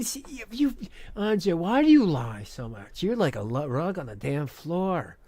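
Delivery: ordinary talk, nothing marked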